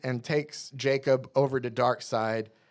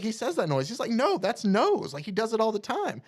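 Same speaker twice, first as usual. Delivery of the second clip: insisting voice; 9 semitones higher than usual. Retold or imitated. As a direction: insist he is right. Voicing Scott insisting.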